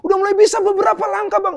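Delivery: high, loud, jubilant calling; imitating an overjoyed friend shouting the news; retold beaming joy. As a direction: call out proudly to show off first steps. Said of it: "muka yang begitu berseri-seri dan bilang".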